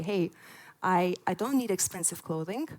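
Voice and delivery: ordinary talk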